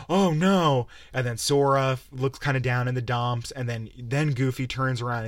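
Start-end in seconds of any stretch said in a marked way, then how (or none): 0.1-0.9 higher, louder, hammed-up oh no; a touch of exaggerated cartoon worry; mock cartoon dismay. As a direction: parody a cartoon sidekick's gasp. Voicing Goofy and Donald's cutscene.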